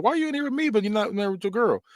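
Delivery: high put-on questioning voice; mock pleading question. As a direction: ask it in an exaggerated high whine. Acting out a character's question.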